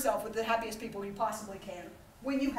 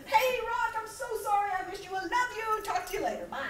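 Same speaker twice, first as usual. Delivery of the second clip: high, sugary phone message; mock-sweet voicemail; 8 semitones higher than usual. Sister leaving brother a fake-sorry voicemail, high and sweet. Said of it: "you leave a message for them".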